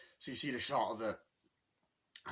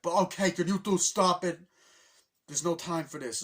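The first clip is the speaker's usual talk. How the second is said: higher, louder scolding; put-on impatient telling-off; mock exasperation; 5 semitones higher, 6 dB louder than usual. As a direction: break up a childish squabble. Acting Peach breaking up squabble.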